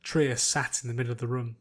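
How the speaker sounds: ordinary delivery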